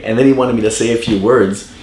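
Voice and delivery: ordinary delivery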